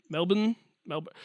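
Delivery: "Melbourne" as MEL-bun, no r; local pronunciation. Shown as a demonstration. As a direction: try out "Melbourne" with a swallowed second syllable. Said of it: "apparently you're supposed to say Melbourne".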